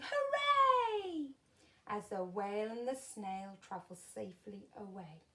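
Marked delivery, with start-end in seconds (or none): 0.0-1.3 high, loud cheer; cheering crowd. A narrator's reading. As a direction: celebrate the rescue. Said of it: "villagers shouting".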